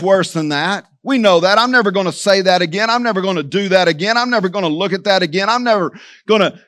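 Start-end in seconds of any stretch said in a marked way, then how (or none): 1.6-6.6 fast, repetitive imitated resolutions; rapid-fire vows. Churchgoer making resolutions, rattled off fast. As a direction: rattle off the promises quickly, one after another. Voicing repeated broken resolutions.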